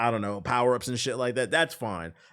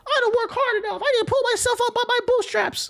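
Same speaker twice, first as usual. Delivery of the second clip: high squeaky mock voice, much higher; mocking falsetto; 5 dB louder than usual. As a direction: sarcastically whine it in a silly high voice. Mocking the streamer's reply.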